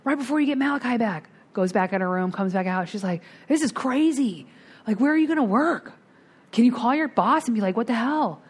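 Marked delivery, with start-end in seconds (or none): 0.0-1.3 high, fast imitation of a panicking sponsor; mock panic. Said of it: "She's in a panic". 3.5-8.4 higher-pitched imitation of a frantic sponsor; mock panic. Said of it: "She's in a panic".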